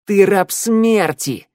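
high, slow, loud cry; shouted accusation. A narrator's reading. Shnyryala exposes the slave of death, shouting. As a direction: hurl the accusation, stretching the words.

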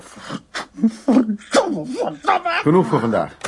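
cursing in a high, far-off rising cry; anguished outburst of swearing; raging curse. Acted. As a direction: let the rage break out, pitch climbing. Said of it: "hier begin ik te razen en te tieren".

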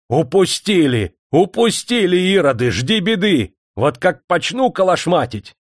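high, drawn-out furious reproaches; threatening shout. A narrator's reading. Manager waving a stick at the workmen, threatening shout. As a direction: rail at them with rising threats. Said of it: "размахивал толстой палкой и грозил".

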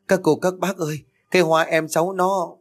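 put-on high, tearful child's plea; child sobbing to neighbours, tearful. A narrator's reading.

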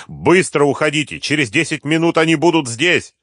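high, loud, urgent order; shouted warning. A narrator's reading. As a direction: shout to hurry them away. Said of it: "закричал быстро поднявшийся старший погонщик". (срочный крик, торопя)

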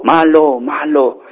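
receding tunnel voice; cave echo. Acted. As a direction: echo dying away in steps. The cave echoes the shout.

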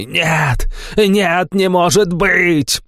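high drawn-out howl of grief. A narrator's reading. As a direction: howl it out in anguish. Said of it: "Он выл в голос".

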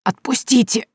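high, strangled cry while choked; choked plea; hoarse gasp. A narrator's reading. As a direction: rasp it out through a squeezed throat. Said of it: "прохрипела Соня".

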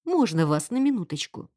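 ordinary delivery

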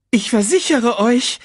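high, loud, nervous voice; anxious assurance. Acted. Sasu explains himself to the hyenas, nervously placating.

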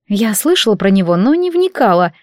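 ordinary delivery

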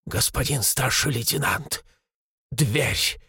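low, subdued and fearful; mildly trembling voice. A narrator's reading. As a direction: report it timidly, a little under your breath. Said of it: "дрожащим голосом произнёс Шестаков". (тихо и низко, с лёгкой дрожью)

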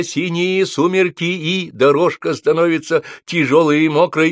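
poem recitation. Acted. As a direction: declaim your own verse, high and measured at first. Character recites his own poem.

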